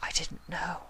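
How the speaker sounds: breathless unvoiced whisper. Rarity stunned, whispering to Luna. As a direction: whisper it in shock. Rarity realizing her mistake.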